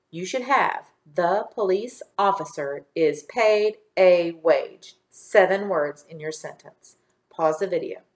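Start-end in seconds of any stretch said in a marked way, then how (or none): 1.0-5.0 counting-out reading with clear gaps; word-by-word dictation. Shown as a demonstration. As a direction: give every word its own beat. Counting words in dictated sentence.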